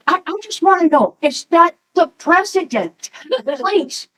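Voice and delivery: high-pitched, mock-desperate appeal; pleading. Woman begs the officer to tell her, pleading. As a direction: plead like an insistent tourist who must know.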